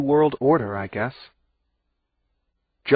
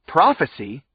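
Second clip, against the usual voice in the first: scoffing; 5 semitones higher, 4 dB louder than usual. A narrator's reading. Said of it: "I scoffed".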